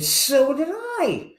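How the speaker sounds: a joke's Irishman thrilled to find a classmate, excited. Acted. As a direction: stretch it out with giddy delight. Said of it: "he got all excited and said".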